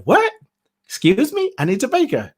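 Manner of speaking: high-pitched puzzled query; mock bafflement. Americans puzzled by how she says a name, imitated bafflement. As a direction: imitate a listener who can't make out the name.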